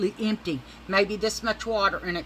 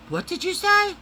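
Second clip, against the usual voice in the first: startled Wanda; 14 semitones higher, 8 dB louder than usual. Retold or imitated. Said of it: "She goes".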